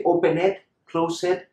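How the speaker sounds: "open it, close it" as o-pen-ED, clo-SED; E wrongly pronounced. Shown as a demonstration. Teacher shows the horrible-sounding mistake, E fully pronounced. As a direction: give "open it, close it" a full extra "-ed" syllable. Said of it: "it sounds horrible".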